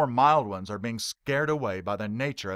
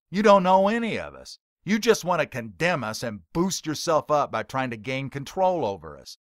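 sharp shout; 3 semitones higher, 5 dB louder than usual. Acted. Heckler shouting down the preacher before the crowd. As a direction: throw it at him as a shout. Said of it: "Billy Mack says back sharply".

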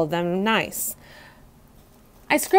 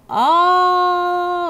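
odd, higher single croak; frog croak; 10 semitones higher, 4 dB louder than usual. A narrator's reading. Frog answering the hamster, a croaked Og.

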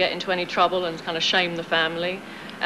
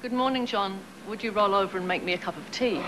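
light British accent on request; a hint of English accent for the host; 4 dB quieter than usual. Shown as a demonstration. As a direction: say it in her London British accent, gently. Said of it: "can I just hear it once".

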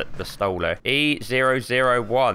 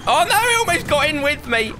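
high startled cry; startled yelp. Real, not acted. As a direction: jump in fright, voice shooting up. Startled by enemy in game.

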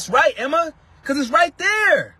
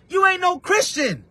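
rebuking shout; 6 semitones higher than usual. Real, not acted. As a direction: call them out from the pulpit. Preacher rebuking doubters.

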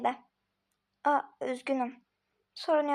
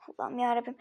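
whispered under the breath, fed up; exasperated whispered aside; 4 semitones lower, 6 dB quieter than usual. Acted. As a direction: hiss it to yourself in exasperation.

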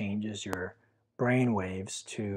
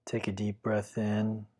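guided meditation. Acted. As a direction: guide the listener gently into stillness. Leads a Yoga Nidra meditation.

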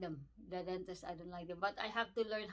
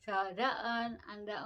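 speaking around fingertip; finger touching lip. Real, not acted. Seen 1: Fingertip touches lower lip, pointing at her teeth while speaking.

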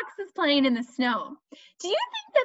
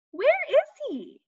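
playful search; 11 semitones higher, faster than usual. Acted. Looking for the doggy.

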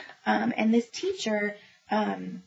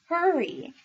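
"Hurry" urged higher with a falling tune; read-aloud hurry; 7 semitones higher than usual. Shown as a demonstration. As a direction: say "Hurry" like dragging a child along. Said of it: "and it says".

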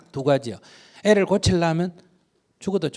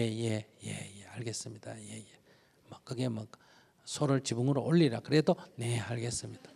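meek yes-saying; 4 semitones lower, 7 dB quieter than usual. Retold or imitated. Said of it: "뭐든지 하면 예".

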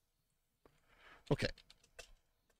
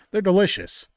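enthusiastic; 8 semitones higher, 9 dB louder than usual. Real, not acted. Praising catfish.

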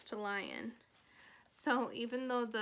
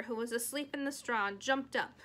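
ordinary delivery

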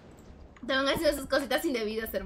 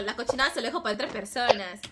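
normal talking voice

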